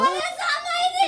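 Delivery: high, drawn-out wail; theatrical wailing. Acted. Wife wailing as the groom leaves, theatrical crying. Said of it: "কান্দা গাড়ি শুরু করছে".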